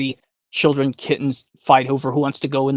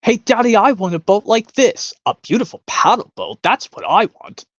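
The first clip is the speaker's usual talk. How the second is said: put-on higher, louder, whiny demanding girl; spoiled Veruca; 7 semitones higher, 3 dB louder than usual. Veruca demanding a boat from her daddy, higher and whiny. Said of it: "from Willy Wonka".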